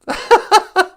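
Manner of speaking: squealing laugh; high laugh. Real, not acted. Laughing at own joke.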